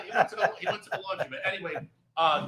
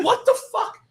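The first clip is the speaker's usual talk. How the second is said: high-pitched, incredulous outburst; retold indignant complaint, half laughing; exasperated protest; 7 semitones higher than usual. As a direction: re-enact baffled outrage, voice pitched up. Retold protest at impossible chore.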